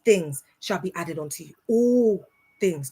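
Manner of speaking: slow, sing-song preacher cadence on seek ye first; mock preacher. Mocking how preachers recite the verse on Sunday. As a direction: ham up a Sunday pulpit reading. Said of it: "they'll be like".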